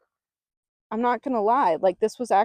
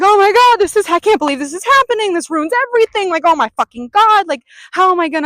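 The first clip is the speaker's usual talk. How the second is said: much higher, much louder hammed-up panic, fast; frantic; 10 semitones higher, 13 dB louder, faster than usual. Showing the frantic energy to avoid, in loud fast mock panic.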